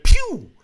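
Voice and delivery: loud, high "pew" whoosh; high-pitched vanishing sound effect; imitated whoosh. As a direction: punch out a sudden zooming-away noise. Imitates stranger vanishing fast.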